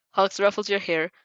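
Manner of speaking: plain reading voice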